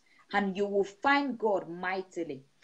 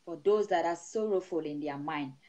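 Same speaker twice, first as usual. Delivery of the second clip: steady, rhythmic prayer over the flock; prayerful blessing; 4 semitones lower than usual. Acted. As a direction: pray over the congregation with rising fervour. Pastor praying over the congregation.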